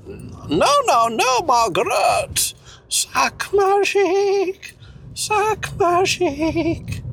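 put-on high hammy French accent; mock French voice. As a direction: ham up a silly French lover. Mock French couple role-play.

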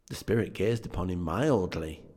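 usual speaking voice

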